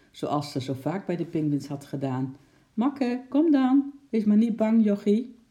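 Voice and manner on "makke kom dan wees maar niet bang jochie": coaxing. A narrator's reading. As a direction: call the penguin out gently but clearly. Coaxing frightened penguin out.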